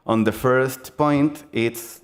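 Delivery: normal talking voice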